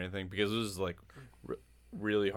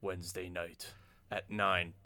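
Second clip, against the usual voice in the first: slow, measured show-intro announcement; spaced-out announcer delivery; 4 dB quieter, slower than usual. Pretend. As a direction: put on a slow podcast intro voice. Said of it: "intro voice here".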